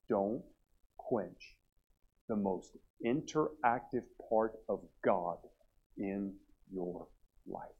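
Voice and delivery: slow and hushed, with weighty pauses; earnest plea. Real, not acted. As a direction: press each phrase home softly. Sermon's closing plea, hushed.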